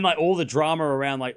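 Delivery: ordinary delivery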